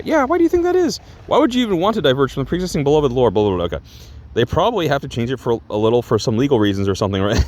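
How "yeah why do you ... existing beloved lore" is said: high, fast mocking fan voice; mocking whiny fan. YouTuber mocking fans who complain about the lore, high and fast. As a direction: parody a whiny fan, pitched way up.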